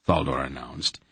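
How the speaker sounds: ordinary delivery, nothing marked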